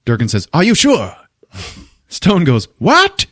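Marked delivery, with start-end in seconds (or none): 0.5-1.1 high, raised questioning call; pitched-up shouted query; calling back. Retold or imitated. Durkin calls back uncertainly. 2.8-3.3 very high shouted what; imitated incredulous yell; yelled question. Stone yells back.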